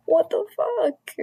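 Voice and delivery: incredulous. Real, not acted. Disbelief at mother's comments.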